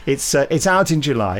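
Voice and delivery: ordinary delivery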